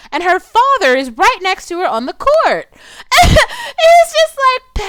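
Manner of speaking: high, loud wail of disbelief, half laughing; exasperated crying laugh. Real, not acted. Vlogger appalled by a man approaching a girl, laugh-crying. Outraged at movie scene.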